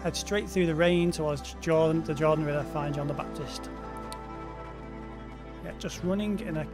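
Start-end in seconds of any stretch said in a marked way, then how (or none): none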